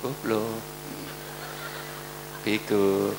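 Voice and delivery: calm low version after the shouting; quiet. Shown as a demonstration. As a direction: say the same insults gently. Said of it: "Lu deket kok teriak. Mbok pelan".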